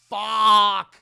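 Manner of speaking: loud, drawn-out mock curse; exasperated shouted curse. Storyteller voices Andy hearing his friend went awol, exasperated shouted curse. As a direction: blurt it out long and loud. Andy reacts to bad news, retold.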